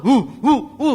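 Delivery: mimicking a toddler who can't talk; punchy little toddler hoots; menirukan anak kecil. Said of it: "nggak bisa mama". (tiruan celoteh anak kecil)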